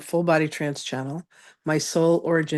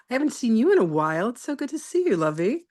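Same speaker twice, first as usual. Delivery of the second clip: higher, gushing welcome; happy greeting; 7 semitones higher than usual. Real, not acted. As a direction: warmly gush over a friend returning. Greeting an old friend.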